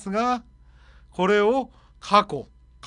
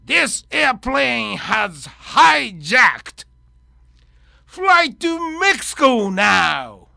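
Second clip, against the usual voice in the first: slow, louder menacing hijacker; 5 dB louder, much slower than usual. Acted. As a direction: bark the demands like a movie hijacker. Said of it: "ハイジャッカー。".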